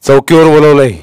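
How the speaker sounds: bullying officer; stern browbeating. A narrator's reading. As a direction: announce it as a summons. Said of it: "दरडावत म्हणाले".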